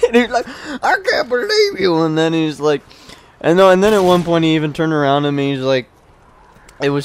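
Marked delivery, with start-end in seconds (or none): 0.8-2.0 very high, tearful-sounding mock tantrum; imitated raging kid. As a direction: wail it like a hammed-up sore loser. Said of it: "raging and raging and raging".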